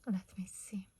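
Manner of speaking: soft whisper to herself. Real, not acted. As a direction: whisper while concentrating. Muttering to herself while crafting.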